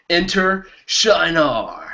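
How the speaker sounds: slow, high, drawn-out herald call; mock-epic proclaiming; grand announcement. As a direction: announce it like a stage entrance. Announcing next section again.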